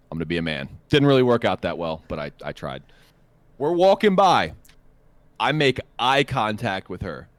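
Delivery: ordinary talk, nothing marked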